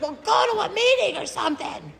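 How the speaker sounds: very high-pitched mock yell; retold yelling. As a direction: imitate a partner snapping back, voice way up. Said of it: "he's yelling at me".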